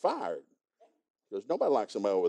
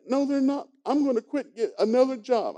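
high, insistent daughter voice; upset daughter; 12 semitones higher, 3 dB louder than usual. Retold or imitated. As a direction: dig in like a stubborn daughter. Daughter answers father.

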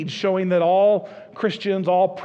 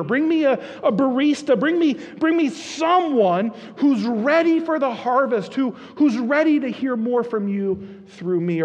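higher, louder pleading prayer; impassioned prayer; 6 semitones higher, 4 dB louder than usual. Acted. Pastor models bold prayers for the congregation, fervent. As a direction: preach the prayer with rising fervour.

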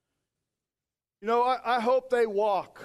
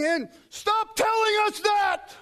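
angry protest; 11 semitones higher, slower than usual. Acted. People shouting at the preacher to stop, indignant high shout. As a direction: shout it high, stretching each word.

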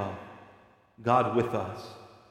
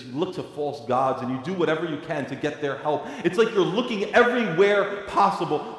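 ordinary talk, nothing marked

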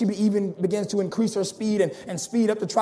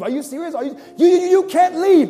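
frightened disbelief; 8 semitones higher, 6 dB louder than usual. Acted. Woman repeats cashier's words fearfully.

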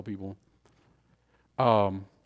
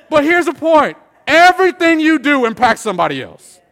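loud, high main point; shouted sermon takeaway; emphatic preaching; 13 semitones higher, 14 dB louder than usual. Real, not acted. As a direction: proclaim it at full force.

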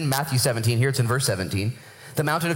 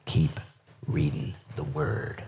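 low, slow, weighty counsel; hushed, deep-set conviction with pauses; truth and power; 12 semitones lower, 6 dB quieter, much slower than usual. Retold or imitated. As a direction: let each word land slowly and softly. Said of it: "with truth and power, he said".

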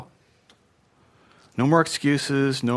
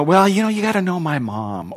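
mock excuse-maker, pitched higher; excuse-making; 5 semitones higher than usual. Acting out someone making excuses.

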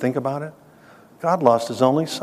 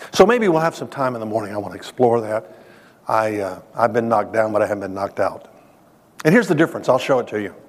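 normal talking voice

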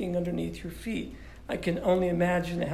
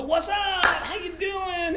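much higher, bright call to a best buddy; casual greeting; 9 semitones higher, slower than usual. Retold or imitated. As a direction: toss it off like greeting your best friend. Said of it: "talk to my best friend and go".

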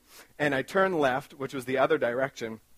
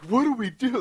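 mock panic; 10 semitones higher, 4 dB louder than usual. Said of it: "and I'm just like".

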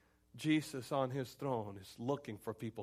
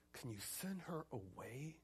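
very soft, slow request; hushed aside; 15 dB quieter, slower than usual. Acted. Disciples asking Jesus about the woman, hushed slow request. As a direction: drop to a barely voiced, drawn-out question.

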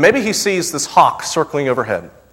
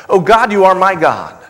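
raised, louder cry to God; fervent quote; 5 semitones higher, 5 dB louder than usual. Acted. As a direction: lift the verse up as a plea. Quotes the verse again.

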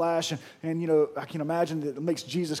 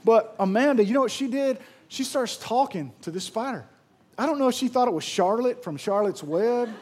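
ordinary delivery

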